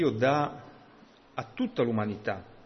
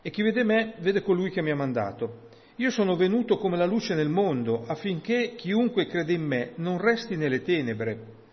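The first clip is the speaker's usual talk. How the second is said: raised, higher declamatory voice; emphatic Bible reading, pitched up; scripture reading; 6 semitones higher, 4 dB louder than usual. Acted. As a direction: proclaim the verse with emphasis. Reads the verse aloud.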